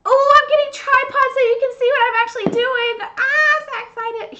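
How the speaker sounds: high, loud excited cry; excited shout. Real, not acted. Thrilled wife hearing husband buys tripods, loud and excited. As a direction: shout it out in delight.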